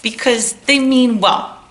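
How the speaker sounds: normal talking voice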